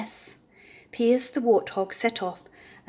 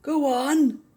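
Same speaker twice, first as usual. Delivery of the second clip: huskily; 6 semitones higher, 4 dB louder than usual. A narrator's reading. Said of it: "said the lion huskily".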